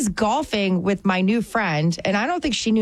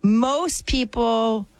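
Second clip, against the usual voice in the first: slow drawl; 3 semitones higher, much slower than usual. Real, not acted. Drawn-out boast.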